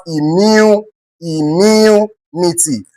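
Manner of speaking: "immune, immunity" slowly, drawing out the "mu"; stressed syllable demonstration. Shown as a demonstration. Teacher showing where stress falls, drawn-out stressed syllable. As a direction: stretch the second syllable of "immunity". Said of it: "if you are to pronounce this word".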